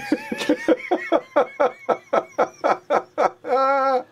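a long, loud, high laugh; a giddy, squealing laugh; high burst of laughter. Real, not acted.

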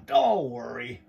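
plain reading voice

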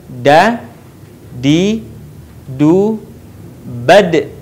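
'Da, di, du, bad' singly, final d bouncing; letter da drill. Shown as a demonstration. Teacher drilling da, separate syllables with bouncing 'bad'. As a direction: sound each syllable, echo the d of 'bad'. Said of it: "terdengar suaranya memantul".

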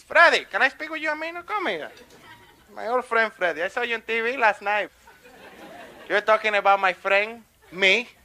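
landlord character, building to a loud warning; confronting landlord. Acted. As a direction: start chummy, then turn loud and scolding. Landlord confronts comedian in lobby.

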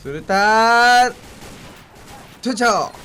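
slow, loud drawn-out callout; grenade warning yell. Real, not acted. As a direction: call the grenade throw out loud.

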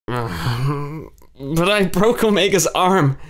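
half growl, half sob; crying confession. A narrator's reading. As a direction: choke it out through tears. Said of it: "She began to growl".

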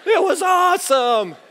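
high-pitched thrilled squeal, drawn out; mock squeal of delight; imitated excited scream. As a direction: shriek it like an overjoyed daughter. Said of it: "She's screaming".

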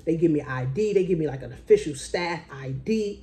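ordinary talk, nothing marked

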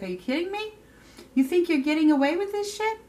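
high, fast indignant disbelief; angry woman. Retold or imitated. Woman calls out the man who cheated her, high indignant disbelief. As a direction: mimic the woman calling him out, high-pitched and fast. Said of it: "She's like".

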